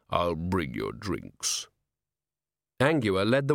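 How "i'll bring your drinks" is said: very low, slow shadowy barman; deep rolling voice. A narrator's reading. Shadow promises drinks, deep and rolling. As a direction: drop it right down and roll it out slowly. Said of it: "in a deep, rolling voice".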